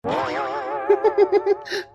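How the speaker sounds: high, drawn-out cackle at the in-laws; mocking laugh. Daughter-in-law laughing at mother-in-law and sister-in-law, high cackle. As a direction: laugh at them openly, high and long.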